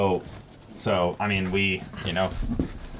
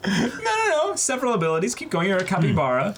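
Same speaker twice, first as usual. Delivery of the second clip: excited protest; 12 semitones higher than usual. Real, not acted. Protests friend calling it useless.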